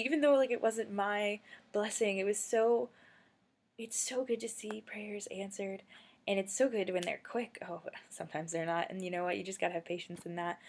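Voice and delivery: usual speaking voice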